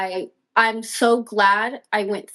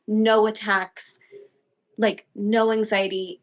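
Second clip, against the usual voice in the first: ordinary talk, nothing marked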